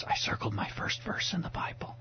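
soft unvoiced whisper. Son whispering in his dad's ear after church, hushed whisper. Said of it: "he whispered in my ears".

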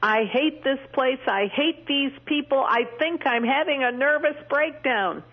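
echoing a sobbing stranger in a prison restroom; retold distraught cry. A stranger sobbing in the prison restroom, distraught and high. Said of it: "I heard her say".

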